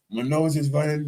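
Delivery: normal talking voice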